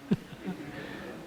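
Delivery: brief, quiet real laugh; soft chuckle. Real, not acted. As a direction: chuckle softly at your own anecdote. Chuckles at the house sign's name.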